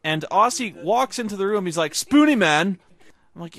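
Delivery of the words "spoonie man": mock needy call, higher and louder; loud, high mock greeting; pestering friend imitation. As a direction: call out like a clingy buddy. Retelling Aussie's whiny interruption.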